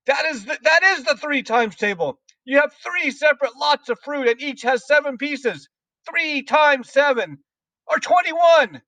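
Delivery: very high, strident headmistress rant; shouted tirade. A narrator's reading. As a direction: berate the pupil at a high, furious pitch. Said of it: "shouted the Trunchbull".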